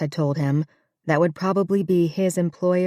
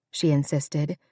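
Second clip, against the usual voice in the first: usual speaking voice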